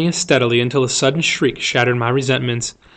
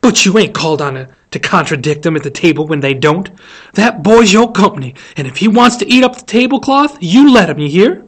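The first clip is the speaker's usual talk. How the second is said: louder, higher, fierce telling-off; fierce scolding; 4 semitones higher, 6 dB louder than usual. A narrator's reading. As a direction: lay down the law fiercely. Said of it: "she whispered fiercely".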